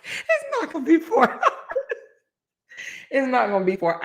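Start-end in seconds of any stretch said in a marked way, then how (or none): 0.0-1.4 high, squeaky words broken by laughter; "hours" lost in a high wheezing laugh. Real, not acted. Host reassuring viewers on length, too tickled to finish. First try collapses into laughter.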